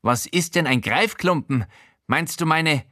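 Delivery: Showalter's voice. Acted.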